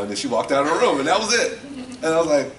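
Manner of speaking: normal talking voice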